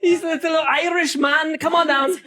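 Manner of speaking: a hint of Irish accent through laughter; mock Irish call, half laughing. Teacher explains the joke in a light Irish accent. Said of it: "an Irish accent comes out of my mouth".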